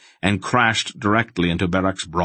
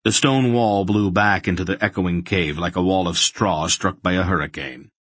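usual speaking voice

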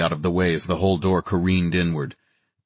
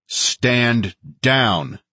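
firm, higher-pitched repeated order; edge in the voice; 4 semitones higher than usual. A narrator's reading. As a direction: keep a hard edge on each word. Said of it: "keeping the edge in my voice".

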